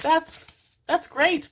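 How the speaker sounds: high, airy mock of a shallow admirer; mock dismissive voice. Mimicking dismissive shallow people.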